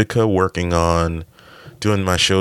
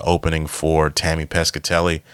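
normal talking voice

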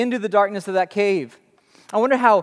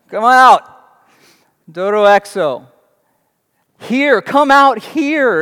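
put-on calling the kids; 3 semitones higher, 8 dB louder, slower than usual. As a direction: call the kids in with mock grandeur. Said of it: "I'm gonna start to say that to my kids".

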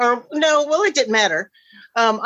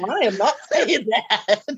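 laughing; 2 semitones higher than usual. Real, not acted. Refusing to tell, laughing.

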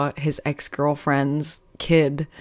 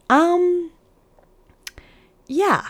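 higher, hesitant imitation of the date; mock awkward date; 12 semitones higher, slower than usual. The date answering the waiter, in a high mock voice. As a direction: squeak out his awkward yes. Voicing the date's awkward reply.

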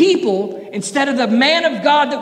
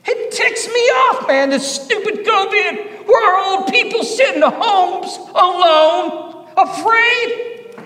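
fired-up preaching; 5 semitones higher than usual. Acted. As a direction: preach it with fire. Preaching at full force.

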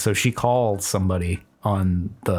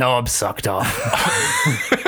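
laughing; 3 semitones higher than usual. Pretend. Silly put-on voice while laughing.

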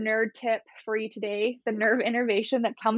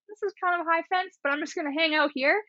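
mock calm; 7 semitones higher, faster than usual. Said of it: "and I was like".

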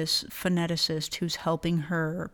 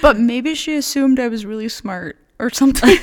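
loud, higher, breaking into laughter; laughing joke; 5 semitones higher, 12 dB louder than usual. Real, not acted. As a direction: push it out loudly through real laughter. Joking through shared laughter.

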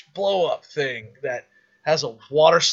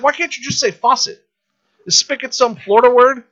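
high, mildly indignant question; imitating wife; 10 semitones higher, 7 dB louder than usual. Wife questioning a Florida word: high mock indignation. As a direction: push back high, a touch indignant. Voices Leanne objecting to the word.

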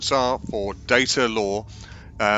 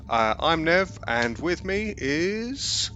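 usual speaking voice

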